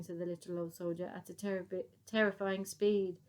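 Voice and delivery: ordinary delivery, nothing marked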